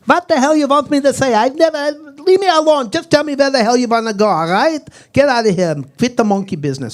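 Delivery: put-on irate, high and loud Polish-accented outburst; dad's Polish accent. Cab-driver dad snapping at his passengers, loud Polish-accented rant. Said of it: "this is the way my dad talk".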